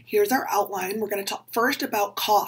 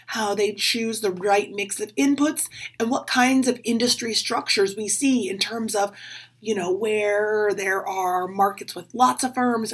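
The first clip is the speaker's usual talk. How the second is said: usual speaking voice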